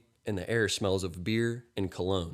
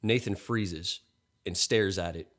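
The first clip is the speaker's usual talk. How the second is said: ordinary delivery, nothing marked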